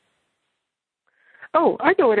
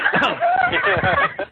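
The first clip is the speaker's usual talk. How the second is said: high giggle. Real, not acted. Laughs at teasing.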